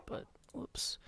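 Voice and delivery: whispered aside, barely voiced; whispered whoops. Real, not acted. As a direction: breathe the words out quietly. Quiet aside after accidental ban.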